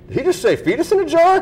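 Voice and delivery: high-pitched, disbelieving question; incredulous onlooker. Retold or imitated. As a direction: act out a stunned viewer's double take. Retold stunned TV viewer.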